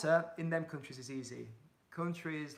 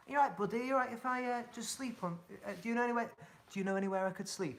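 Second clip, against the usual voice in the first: friendly ask to a farmer; 7 semitones higher, faster than usual. Retold or imitated. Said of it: "knock on his door".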